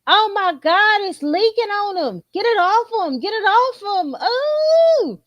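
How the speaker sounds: high, frantic mock alarm; hammed-up horrified squeal; mock panic. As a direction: cry out as if something nasty is spilling. Mock panic over spilled stupidity.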